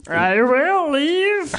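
high, slow squawky eagle voice. Pretend. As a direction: talk like a giant bird. Eagle voice mock answer.

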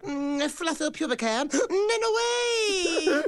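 high, excited creepy voice; creepy villain voice. Acted. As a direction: leer and giggle as the attacker.

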